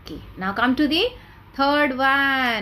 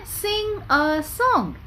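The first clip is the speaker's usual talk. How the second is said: slow, drawn-out, a bit higher; slow model phrase; 6 semitones higher, much slower than usual. Shown as a demonstration. Teacher slowly models the phrase for children. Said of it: "shall we say".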